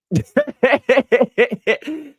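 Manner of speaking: loud, high burst of laughter; hearty laugh. Real, not acted. Host cracks up at a viewer's comment, real loud laugh.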